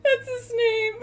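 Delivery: high, loud, choked with laughter; laughing. Real, not acted. Streamer naming her mask while cracking up, choked laughing. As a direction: squeeze the words out through laughter.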